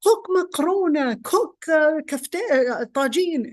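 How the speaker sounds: imitating an angry husband, high and loud; angry outburst. Fed-up husband finally names dishes to his wife, angry outburst. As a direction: burst out with dish names in retold anger. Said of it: "he got really angry and he said".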